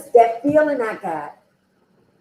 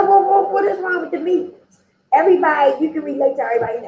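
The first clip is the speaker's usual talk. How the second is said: higher-pitched imitation of a puzzled counselor; questioning counselor; 6 semitones higher, faster than usual. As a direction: voice the counselor challenging an objection. Voices counselor questioning her objection.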